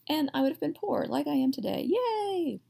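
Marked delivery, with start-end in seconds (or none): 1.9-2.6 high, drawn-out mock "yay"; mock cheer. Teacher jokes about being poor today, high mock cheer. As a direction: cheer in a high, long, sustained pitch.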